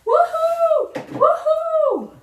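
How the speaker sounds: high, loud woohoo; loud celebratory holler; whooping cheer. Real, not acted. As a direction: let out a high-pitched whoop. Cheering during livestream.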